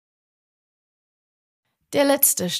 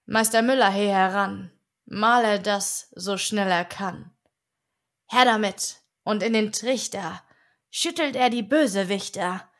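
ordinary delivery

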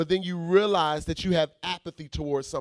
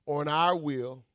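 ordinary delivery

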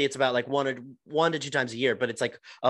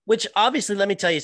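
much higher and louder cut-in; eager interjection; 9 semitones higher, 5 dB louder, faster than usual. Real, not acted. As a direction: burst in, dying to add something. Jumps in eagerly on topic.

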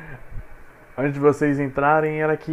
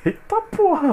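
startled; 13 semitones higher than usual. Real, not acted. Sudden fright, almost broke arm.

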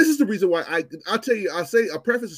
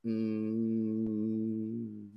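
usual speaking voice

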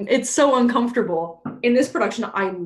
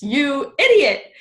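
slow, higher, louder "You idiot"; exasperated insult; 7 semitones higher, 8 dB louder, slower than usual. Retold or imitated. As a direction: scold him like a fool. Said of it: "And Oberon's like".

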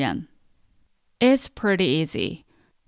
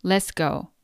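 ordinary delivery

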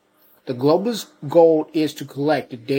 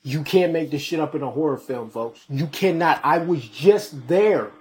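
ordinary talk, nothing marked

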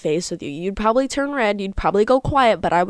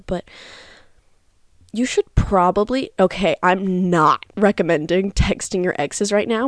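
usual speaking voice

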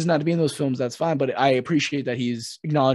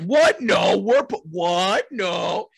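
high, louder, stunned stammer; mock shocked Riddler; 4 semitones higher, 4 dB louder, much slower than usual. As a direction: play the Riddler caught off guard. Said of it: "he's like".

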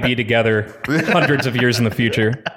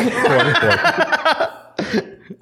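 mock Swedish chef; 14 semitones higher than usual. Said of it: "That's Swedish!".

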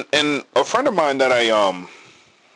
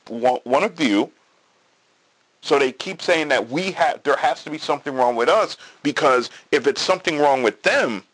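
ordinary delivery

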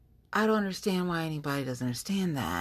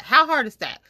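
higher, louder exasperated question; exasperated repeat; 8 semitones higher, 8 dB louder than usual. Real, not acted. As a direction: throw up your hands as you repeat it.

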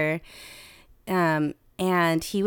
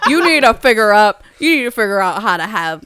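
awkward dad; 11 dB louder than usual. Retold or imitated. Dad's safe sex talk quoted.

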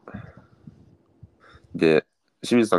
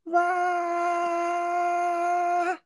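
high falsetto "wah" cheer; mock crowd cheer. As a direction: fake a cheering crowd sound effect. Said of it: "中山のわーじゃなんかね". (歓声の効果音)

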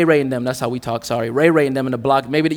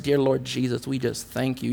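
much softer, hushed parody of a churchy prayer; mock pious prayer; 3 semitones lower, 9 dB quieter than usual. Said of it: "people think when Christians pray".

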